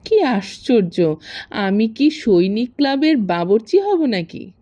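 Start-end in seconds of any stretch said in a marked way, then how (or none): none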